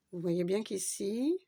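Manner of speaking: ordinary talk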